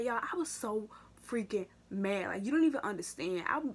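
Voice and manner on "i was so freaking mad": slow, whispered emphasis on freaking mad; whispered anger. Real, not acted. A vlogger telling viewers how mad she was, slow whisper. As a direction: drop to a whisper to stress the anger.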